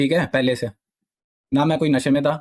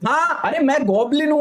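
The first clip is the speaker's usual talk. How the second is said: much higher, sneering imitation of a braggart; mocking the goblin guy; 11 semitones higher than usual. Telling chat how the guy bragged, high mocking imitation. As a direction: mimic the bully's boast in a high, taunting voice. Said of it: "soul का face".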